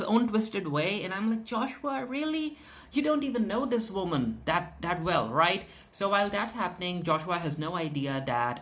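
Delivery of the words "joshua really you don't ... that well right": high pitched, incredulous; can't believe it, voice jumping up; exasperated disbelief. Real, not acted. Exasperated remark to a character.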